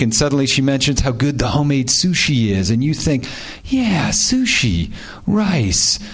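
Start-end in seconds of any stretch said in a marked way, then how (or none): 3.7-6.0 high, very slow musing as the penny drops; slow dawning thought. Pretend. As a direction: drag each word out as the idea slowly lands. Imagined thought at a party.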